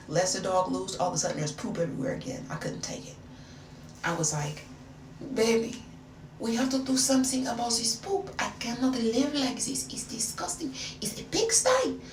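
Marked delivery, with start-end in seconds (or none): 5.3-12.0 high-pitched complaint in a mock French accent; put-on French accent. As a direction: fuss like a comic French woman, accent and all. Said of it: "I still had the accent".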